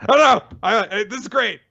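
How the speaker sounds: high, delighted, loud order; gleeful villain. Retold or imitated. Orochi ordering Hiyori's death, high gleeful. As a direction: gloat like a cackling tyrant. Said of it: "And Orochi says".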